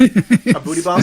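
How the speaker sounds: loud, slow, laughing disbelief. Real, not acted. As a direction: laugh the question out. Incredulous question amid laughter.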